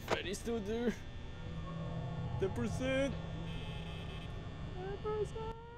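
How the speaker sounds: tiny, high, softened squeal; frightened. Real, not acted. Player watching Freddy at ten percent, frightened. Freddy near, power running out.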